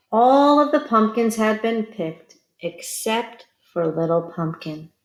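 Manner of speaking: ordinary delivery, nothing marked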